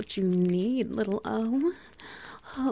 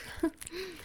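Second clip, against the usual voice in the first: quiet, breathy giggle up high; a quick delighted giggle; soft high giggle; 10 semitones higher, 6 dB quieter than usual. Acted. Dominant lover giggles softly, delighted. Dominant partner laughing mid-scene.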